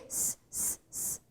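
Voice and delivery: S, S said as a long voiceless hiss; hissed S sound. Shown as a demonstration. Teacher showing the S sound to students. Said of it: "um som de S".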